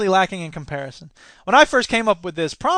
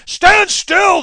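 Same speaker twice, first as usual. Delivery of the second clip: loud, high monster yell; wailing creature; 12 semitones higher, 9 dB louder than usual. A narrator's reading. Creature chasing its dodging prey, loud wail. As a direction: wail it as a frustrated brute. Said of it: "the creature wailed".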